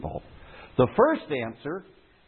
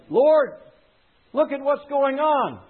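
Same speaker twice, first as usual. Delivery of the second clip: much higher and louder plea to the Lord; Elijah crying out; 10 semitones higher, 3 dB louder, slower than usual. Acted. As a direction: call up to God in despair. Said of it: "And he said".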